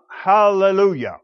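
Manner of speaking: loud, high ringing Hallelujah; a rising shout of praise; crying out. A narrator's reading. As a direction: proclaim it like a great multitude in heaven. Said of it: "crying out".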